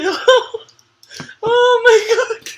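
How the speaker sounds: high, loud mock exclamation; grandma's gasp, hammed up; imitating nana. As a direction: shriek it like an excited grandma. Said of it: "she would say".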